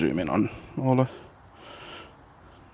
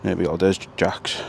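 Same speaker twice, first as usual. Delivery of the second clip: normal talking voice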